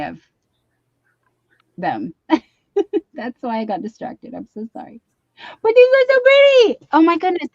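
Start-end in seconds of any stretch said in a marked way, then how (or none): 5.6-7.5 high, loud gushing over gifts; gushing delight. Real, not acted. Admiring the friend's gifts, high and gushing. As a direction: swoon over how pretty they are.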